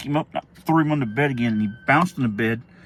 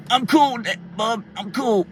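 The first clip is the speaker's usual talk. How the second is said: put-on high, loud kid calling out; imitated little brother; 11 semitones higher, 4 dB louder, slower than usual. Little brother calling up from the floor, high and eager. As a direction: pipe up high like an excited kid.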